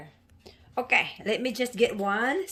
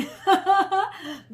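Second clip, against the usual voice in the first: laugh; 10 semitones higher, 6 dB louder than usual. Real, not acted. Laughs after own comment.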